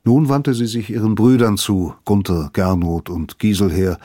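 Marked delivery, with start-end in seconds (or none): none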